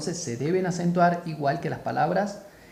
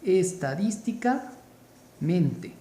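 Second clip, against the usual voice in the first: "Estadísticamente" drawn out slowly, pausing before mente; slow word building; much slower than usual. Shown as a demonstration. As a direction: spell out "Estadísticamente" slowly as stem, then suffix. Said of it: "le agregamos el sufijo mente".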